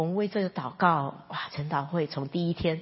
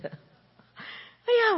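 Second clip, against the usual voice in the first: high, startled cry; surprised exclamation. Retold or imitated. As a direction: gasp it out in sudden realization. Realizing the pale skin meant anemia.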